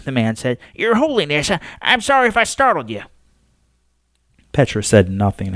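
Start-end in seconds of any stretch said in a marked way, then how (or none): 0.7-3.0 higher, quick, stammering apology; nervous old man. Acted. Astronomer apologises to the Pope, nervous and hurried. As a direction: rush the sorry, tripping over words.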